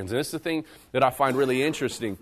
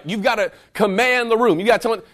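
louder, higher imitation of a yelled order; retold yelling; 6 semitones higher, 6 dB louder than usual. Movie instructors urging the timid recruit to command, yelling. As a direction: push it out like an exasperated instructor. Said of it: "they're, like, they're yelling".

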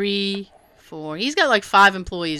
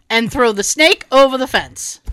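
usual speaking voice

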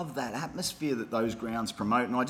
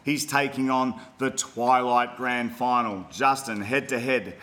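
announcer intro; 4 dB louder than usual. Acted. Announcer introduces next segment.

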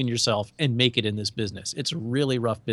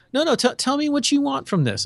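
much higher, mock-innocent Shatner voice; Shatner impression; 12 semitones higher than usual. Shatner mocking the engineer, high put-on voice. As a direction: play dumb in a lifted put-on voice. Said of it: "He's like".

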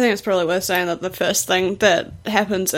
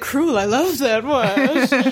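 slow, savoring the word like a gleeful villain; drawn-out delight with a laugh in it; relishing villain; 4 semitones higher, much slower than usual. Retold or imitated. General Blue savoring cruelty, slow and gleeful.

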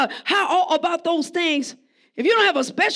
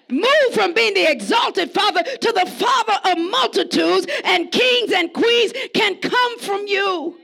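preaching at full force; 5 semitones higher than usual. Real, not acted. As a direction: lift the church to its feet. Preacher building to climax.

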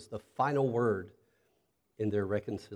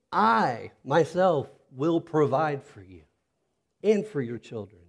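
I myself punched, slow and higher; emphatic I; 4 semitones higher, 6 dB louder than usual. Shown as a demonstration. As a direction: lean hard on I myself, slowly. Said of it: "the word "I" is in, emphatic".

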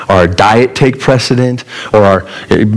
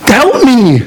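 much higher, pleading frantic complaint; tantrum at God; 13 semitones higher than usual. Retold or imitated. Preacher on the cliff edge complaining to God, high frantic whine. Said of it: "I'm having this temper tantrum".

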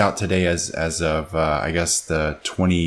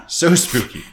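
higher, amused repeat through laughter; laughing. Real, not acted. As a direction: say it between bursts of laughter. Laughs while repeating reaction to deer.

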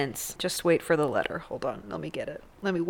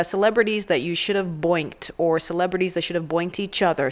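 ordinary delivery

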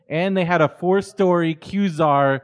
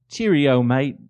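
clumsy British greetings, veering Australian; attempted British accent; 3 semitones lower, slower than usual. Shown as a demonstration. Guest put on the spot, awkward mock British. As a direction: try British and land nearer Australian. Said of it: "Can you do a British accent?".

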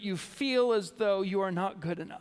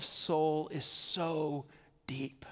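hushed deep; 4 semitones lower, 4 dB quieter, much slower than usual. Acted. Preacher to congregation, on sorrow, whispered. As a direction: drop almost to a whisper on deep.

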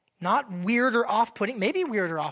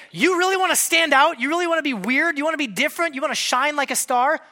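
higher and louder, a rousing challenge; fired-up Paul; 5 semitones higher, 4 dB louder than usual. Retold or imitated. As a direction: challenge them, punching each question. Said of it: "He's like".

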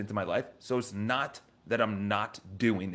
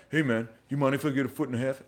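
curt, fast, challenging demand; confrontational; 2 semitones higher, faster than usual. Shown as a demonstration. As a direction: snap it out, no smile. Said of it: "and not".

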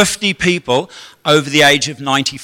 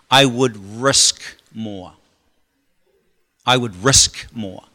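slow emphasis; 5 semitones lower, slower than usual. Shown as a demonstration. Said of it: "repeated key line".

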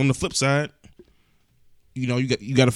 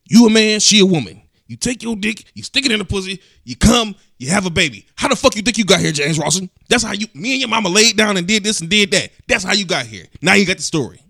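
higher, louder blunt lecturing father; dad giving sex talk; 7 semitones higher, 7 dB louder than usual. Retold or imitated. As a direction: lay it out loud like a no-nonsense dad. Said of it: "That was my sex talk".

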